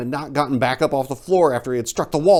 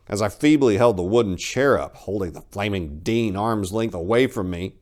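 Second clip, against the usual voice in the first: usual speaking voice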